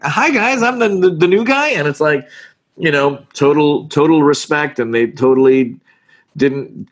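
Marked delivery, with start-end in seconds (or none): 0.0-1.7 high, stammering little newcomer voice; mock timid greeting. Newcomer greeting thirty-year veterans, high mock-timid stammer. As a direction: pipe up high as the nervous new kid.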